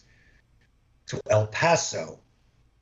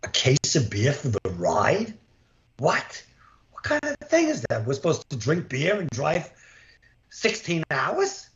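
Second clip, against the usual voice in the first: incredulous inner rant. Retold or imitated. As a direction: imitate baffled outrage at the beer. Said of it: "I'm going".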